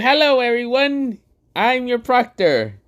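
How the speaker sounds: high, slow showman voice; Gojo voice. Acted. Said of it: "Gojo appears saying".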